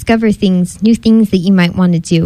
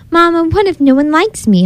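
put-on higher, anxious child's question; worried cub; 9 semitones higher than usual. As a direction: ask Mama with mild storybook worry. Worried cub asks his mother.

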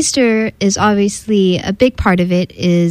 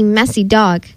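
ordinary delivery

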